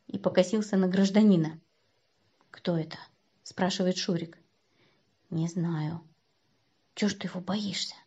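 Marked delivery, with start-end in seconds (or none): 2.5-3.0 low, soft wary question; hushed question. A narrator's reading. Shurik asks Kostya about the stranger, hushed. Boys whispering in cinema. 5.3-7.9 soft conspiratorial undertone; hushed. A narrator's reading. Boys confer in the cinema, hushed.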